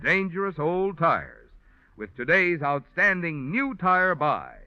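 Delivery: ordinary delivery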